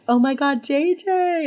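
high-pitched gushing delight. Retold or imitated. Emily to pregnant J J, gushing.

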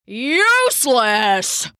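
loud, slow disgusted yell; narrated old man's angry yell; Papa yelling. A narrator's reading. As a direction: bellow it at the cat as Papa. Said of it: "Papa yelled".

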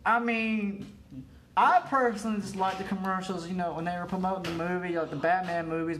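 ordinary delivery

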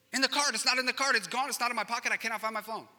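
high, frantic, whiny repeats; panicked kid. Retold or imitated. Son insists the phone is gone, frantic and high. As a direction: voice the son's rising panic.

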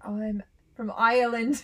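laughing mock Australian; hammed-up Aussie slip; demoing accent drift, mock Australian. Shown as a demonstration. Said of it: "dive into an Australian accent".

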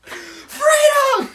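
very high, loud battle cry; Mel Gibson freedom yell. Pretend. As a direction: scream it like a movie battle cry. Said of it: "Mel Gibson screaming freedom".

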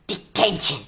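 sharp high stern shout; strict teacher shouting at a student. A narrator's reading. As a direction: bark the punishment at the pupil caught out of bed. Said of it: "she shouted".

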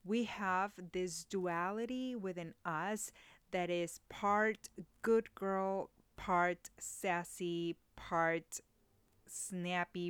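ordinary delivery

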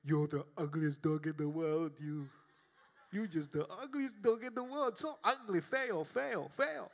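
sing-song high teasing voice; mock insult to dog. Pastor teasing his dog in a sweet voice. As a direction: coo insults like sweet talk. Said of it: "look at that dog and say".

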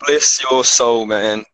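ordinary delivery

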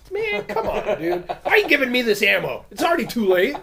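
high-pitched, rising complaint; mock exasperated protest. Storyteller replaying his answer to his friend's picture, exasperated protest.

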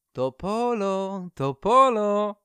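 high, slow, loud sing-song call. Acted. The birch calls loudly across the lake to her old friend. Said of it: "śpiewne nawoływanie".

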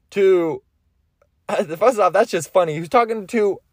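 ordinary talk

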